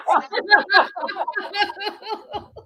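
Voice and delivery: high bright laugh; high laughter. Real, not acted. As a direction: break into laughter. Laughing at the joke.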